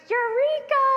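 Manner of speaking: very high, loud triumphant shriek. Retold or imitated. Girl striking gold by the river, shrieking in triumph. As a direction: scream it like you just struck gold. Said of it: "and I just shriek".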